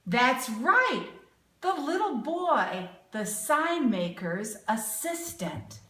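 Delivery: ordinary delivery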